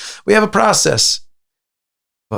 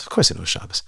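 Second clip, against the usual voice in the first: hushed knowing whisper; whispered retort; 4 semitones lower, 6 dB quieter, much faster than usual. Real, not acted. Speaker undercutting the excuse, whispered. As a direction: breathe it out as a knowing aside.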